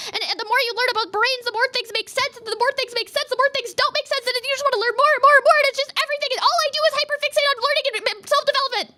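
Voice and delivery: high, breathless rush that keeps climbing; carried-away rambling. Real, not acted. Carried away explaining a hyperfixation.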